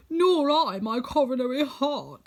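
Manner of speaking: Tin Woodman. Acted. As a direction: voice him slow and ringing, a tin man's complaint.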